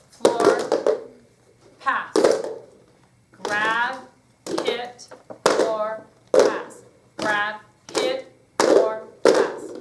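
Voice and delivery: punchy called-out moves, slowing at stop; loud rhythmic cues. Shown as a demonstration. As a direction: drive the class with your voice. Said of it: "I controlled the tempo".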